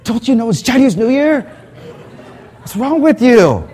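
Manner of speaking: put-on high, scolding older lady voice; scolding church lady. Church lady scolding a clueless newcomer, high mock scold. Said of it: "she just scolded me".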